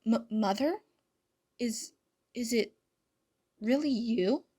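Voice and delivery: halting, stammered question; stunned disbelief. A narrator's reading. Adrien hearing his lost mother on the phone, stunned. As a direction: stammer it in pieces, rising in disbelief.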